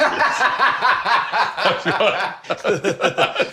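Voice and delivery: big bursting laugh; loud laugh. Real, not acted. Comedian bursts out laughing at his own joke.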